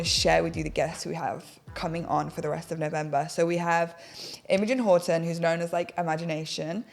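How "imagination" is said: ordinary talk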